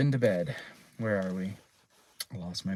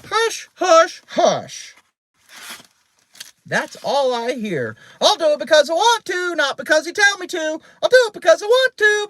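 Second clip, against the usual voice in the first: high, loud parrot squawk; piercing, bossy bird rattling on; Penelope; 7 dB louder than usual. A narrator's reading. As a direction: squawk back mockingly like a parrot. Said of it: "Penelope blinked and said".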